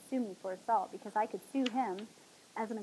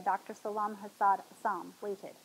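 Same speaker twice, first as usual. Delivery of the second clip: ordinary delivery, nothing marked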